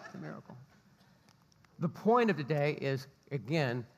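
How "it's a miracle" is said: much softer, laughing aside; softer laughing repeat. Real, not acted. Pastor repeating the joke softly under his breath, laughing. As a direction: chuckle it under your breath.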